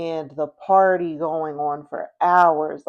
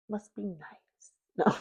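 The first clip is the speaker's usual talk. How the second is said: sarcastic aside; 3 semitones higher, 10 dB quieter than usual. Real, not acted. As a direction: drop to a tiny, envious-sounding aside. Sarcastic aside about the rich.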